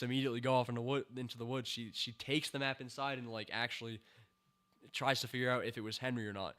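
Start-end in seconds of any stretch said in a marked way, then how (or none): none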